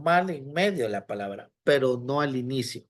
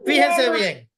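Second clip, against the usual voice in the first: llamando la atención; 7 semitones higher, 8 dB louder than usual. Real, not acted. Teacher calls class attention.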